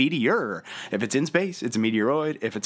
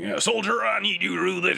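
barked, higher army order; drill sergeant voice; 7 semitones higher than usual. Retold or imitated. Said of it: "talked like a drill sergeant".

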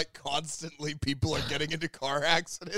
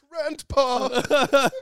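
high, loud laughing cartoon shout; anime cry; 11 semitones higher, 11 dB louder than usual.

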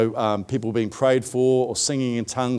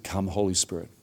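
hushed, lower invocation; quiet prayer; 6 semitones lower, 8 dB quieter than usual. Retold or imitated. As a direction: pray it softly to yourself. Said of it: "sat there and said".

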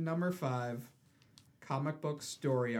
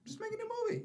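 very high-pitched mock pleading; high comic appeal, falling at the end; exasperated plea; 3 dB quieter, much faster than usual. Repeated plea, escalating.

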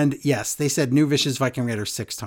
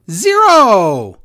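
exasperated shout; 5 semitones higher, 8 dB louder than usual. Real, not acted. Exasperated answer to own question.

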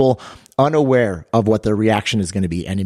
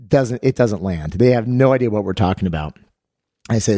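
ordinary talk

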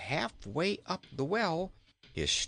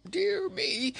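very high gasped exclamation; 4 dB louder than usual. A narrator's reading. King surfaces in the well, high acted gasp. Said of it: "gasped the king".

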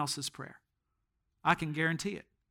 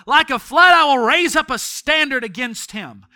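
verse cried out higher and loud; shouted verse; 5 semitones higher, 10 dB louder than usual. Acted. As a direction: declare the verse at full force. Preacher shouts the verse at climax.

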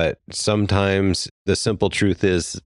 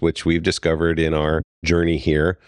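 usual speaking voice

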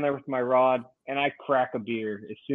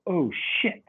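higher-pitched, rueful oath; retold dismay; 5 semitones higher than usual. Angler retelling being told it's all illegal, dismayed. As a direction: replay the sinking realization.

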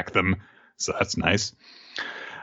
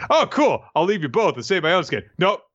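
mock cheerful; 7 semitones higher than usual. Sarcastic imagined Usopp line.

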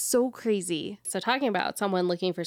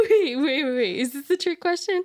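laughing; 7 semitones higher, 4 dB louder than usual. Real, not acted. Laughing disbelief.

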